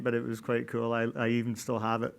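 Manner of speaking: ordinary talk, nothing marked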